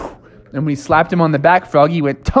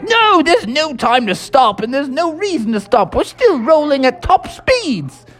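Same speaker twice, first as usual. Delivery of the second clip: stubborn jolly Toad; 11 semitones higher than usual. Acted. Mister Toad refusing to stop.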